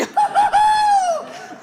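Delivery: high, loud mocking cackle; mocking laugh. Baker laughing at the customer, high mocking cackle.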